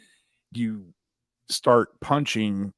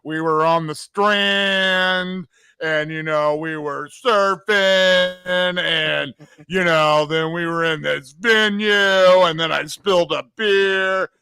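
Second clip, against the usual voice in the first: high, loud, drawn-out impression of a punk singer; 7 semitones higher, 6 dB louder, slower than usual. Co-host plays punk singer reminiscing about surfing, high drawling impression. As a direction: stretch the word endings out, pitched up and loud. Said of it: "me trying to be [name], acting like [name]".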